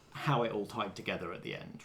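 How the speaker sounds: ordinary delivery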